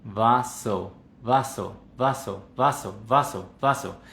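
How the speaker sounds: "vaso" with jaw and lips held still; barely moving mouth. Shown as a demonstration. Teacher demonstrating the experiment, still-mouthed "vaso". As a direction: say "vaso" as if numbed, without opening the jaw. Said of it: "yo lo voy a decir".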